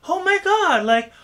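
put-on high, loud valley girl gush; "oh my God, totally" in falsetto valley girl. Shown as a demonstration. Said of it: "if you talk like a valley girl".

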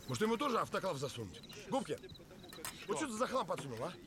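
yelling accusation, pitch jumping up; angry. Acted. Heated argument over broken item. (злой крик)

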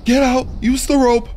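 frantic high, loud yelling, building to a shriek; panicked escape. Real, not acted. Player fleeing a horrifying house in a game, panicked yelling.

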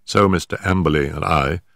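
usual speaking voice

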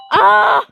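sharp loud cry of dismay; loud scream. Real, not acted. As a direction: scream as you fail. Dies in game.

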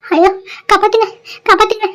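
high, panicked call for help; frantic high cry to be saved. Acted. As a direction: shout for rescue while going under. Drowning man cries for help.

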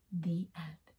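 soft unvoiced whisper. A narrator's reading. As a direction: breathe the ending softly. Closing the story softly.

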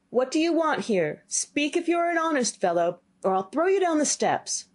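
high, fierce warning; threatening boy. Acted. Boy threatens the silent figure, high fierce warning. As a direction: dare the ghost to speak, pitched high.

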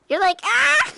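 a short high squeal of fake rage; mock Elmo scream. Friend mocking his teammate's rage quit in Elmo squeal. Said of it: "you sound like Elmo having a rage quit".

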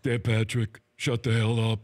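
low, put-on voice; impersonating the guest. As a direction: do a deep-voiced mock put-down. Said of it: "my impersonation with you".

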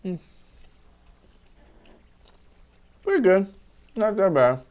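talking between bites of burger; muffled by food in mouth; mouth full while chewing. Real, not acted. Seen 0: Bites burger, lips closed and chewing across labelled frames.

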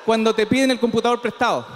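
ordinary delivery

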